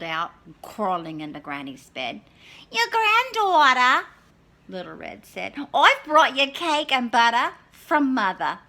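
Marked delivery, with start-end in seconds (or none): 2.7-4.1 put-on very high, loud girl call; Little Red. Little Red answering at Granny's door, high. 5.7-8.6 put-on higher, louder girl voice; Little Red. Little Red at the door to Granny, high and bright.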